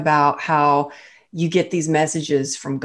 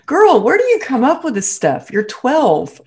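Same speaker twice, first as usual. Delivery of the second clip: high, louder mock astonishment at her child; playful incredulous scolding, pitched up; amused disbelief; 8 semitones higher, 4 dB louder than usual. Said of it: "And I was like".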